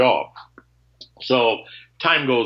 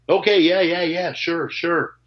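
mock higher-pitched, hurried yeses; glib agreement; 5 semitones higher than usual. Buyer promising to call before selling, glibly agreeing. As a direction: imitate someone agreeing just to move on. Retells guy's careless promise.

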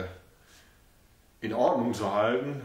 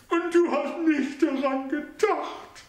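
much higher, slow, wounded question; slow high voice of a wounded old lady; broken old lady. A narrator's reading. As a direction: sound deeply hurt, slowly. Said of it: "Stimme einer gebrochenen, hinwelkenden Dame".

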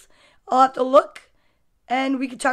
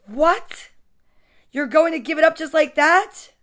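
incredulous question, louder and rising; astonished mother; 3 semitones higher, 4 dB louder than usual. Acted. Mom questions her son giving up a toy, astonished. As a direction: react in mild disbelief as a story mom.

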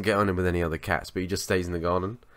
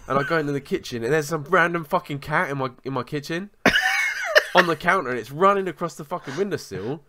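ordinary delivery

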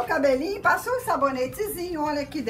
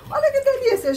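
much higher and louder, bursting with delight; delighted gush; 11 semitones higher, 6 dB louder than usual. Real, not acted. Mother showing her newborn to the viewers, delighted gush.

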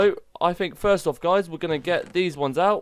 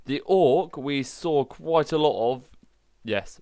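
normal talking voice

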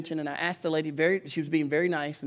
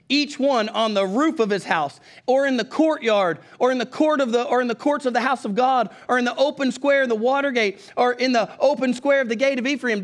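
forceful scripture reading; 6 semitones higher, 5 dB louder than usual. Acted. Preacher reading the verse to his church, loud and emphatic.

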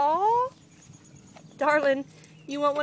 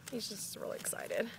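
whisper; 3 semitones lower, 13 dB quieter than usual. Real, not acted. Whispered aside about dog.

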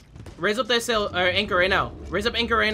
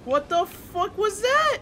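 1 startled; 10 semitones higher, slower than usual. Real, not acted.

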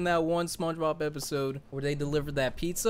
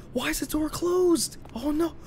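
very high desperate pleas, breaking into whispers; begging in real panic; panicked pleading. Real, not acted. Stuck player pleading to escape, high and panicked. Can't move, door closed.

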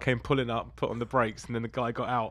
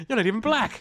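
high put-on protest; pitched-up mock indignation; mock outrage; 10 semitones higher, 3 dB louder than usual. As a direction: challenge an impostor, hammed up. Acting the same challenger.

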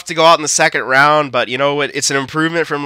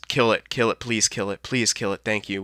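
quiet pleading; 3 semitones lower, 11 dB quieter than usual. Real, not acted. Penalty kill, quiet pleading.

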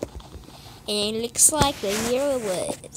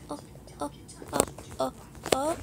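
ordinary talk, nothing marked

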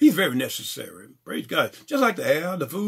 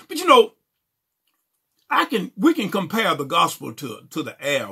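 usual speaking voice

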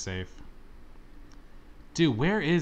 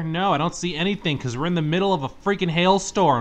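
irritated retort; 5 semitones higher, 5 dB louder than usual. Real, not acted. Snapping back at game's question.

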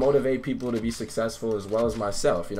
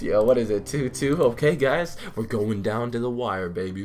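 usual speaking voice

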